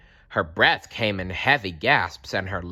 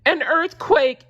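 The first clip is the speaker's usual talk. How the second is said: much higher and louder startled squeak; frightened yelp; 7 dB louder than usual. A narrator's reading. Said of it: "yelped Mole".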